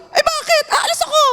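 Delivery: high mock-defiant retort; defiant young wife. Pastor voicing her younger self defying her husband, high mock defiance. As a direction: snap back like a stubborn young bride. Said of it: "sabi ko".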